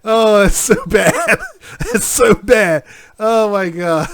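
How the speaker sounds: loud laugh through the words; cracking up, can barely speak; laughing loudly. Real, not acted. Laughing at the picture.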